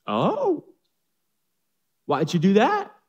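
a wife caught off guard; surprised wife. Acted. Noah's wife reacts.

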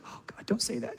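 a fearful little hushed plea; hushed whisper. Retold or imitated. Wife hushing husband who says life is good, whispering. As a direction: whisper it, spooked by the jinx.

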